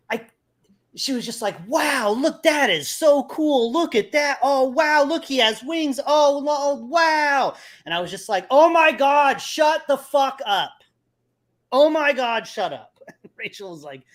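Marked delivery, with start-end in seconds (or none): 1.7-7.5 high, loud mock amazement; loud running commentary. Woman talking over the fight scene, loudly amazed. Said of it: "she was talking over the fight scene". 8.5-13.0 high, exasperated retold shout; loud and angry. Moviegoer fed up with woman talking, loud and angry. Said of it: "I was so loud and so angry".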